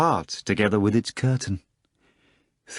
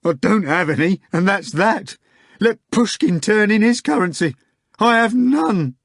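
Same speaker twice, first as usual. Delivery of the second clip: frantic protest; 12 semitones higher, 5 dB louder than usual. Acted.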